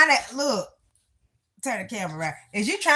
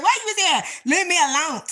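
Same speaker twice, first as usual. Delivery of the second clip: higher-pitched, animated retelling; retold exchange with husband; 6 semitones higher than usual. Retelling husband's comment on her talk, higher animated quote. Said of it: "My husband said".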